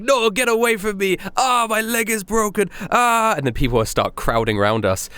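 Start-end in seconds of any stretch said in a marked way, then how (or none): 0.0-3.3 much higher, hammed-up pained cry; fake injured man. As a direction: wail it like a fake victim. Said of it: "He's like".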